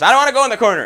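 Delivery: loud, high mock protest; defiant little boy. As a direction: refuse like a stubborn schoolboy. Boy defies teacher's order.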